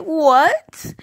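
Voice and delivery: high, loud shocked "what?"; a squeal of shock at the channel count. Retold or imitated. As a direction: yelp it out in total disbelief. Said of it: "and I was like".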